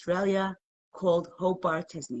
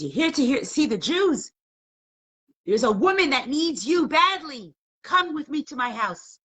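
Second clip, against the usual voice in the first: raised, urgent acted voice; distraught husband; 7 semitones higher, 4 dB louder than usual. Husband pleading with visiting rabbi for his wife, imitated desperation. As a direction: beg for help, pitched high. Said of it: "being a distraught husband".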